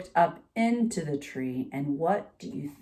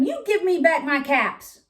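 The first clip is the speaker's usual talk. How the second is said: higher, louder demand for the caps; scolding peddler; 10 semitones higher, 5 dB louder than usual. A narrator's reading. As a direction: scold the monkeys, firm but not yet furious. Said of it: "shaking his finger at them".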